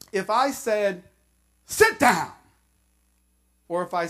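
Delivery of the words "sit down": curt order, a bit louder; firm order to sit; stern command. Shown as a demonstration. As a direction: snap it as a command. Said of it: "because of the tone of my voice".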